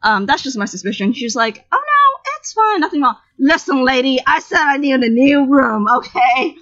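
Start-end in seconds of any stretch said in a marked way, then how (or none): 1.7-3.2 very high-pitched mock reassurance; imitated sing-song clerk, pitched way up; dismissive receptionist. Imitating receptionist brushing off complaint. 3.4-6.5 acted exasperation, pitch raised; mock-irate hotel guest; insistent complaint.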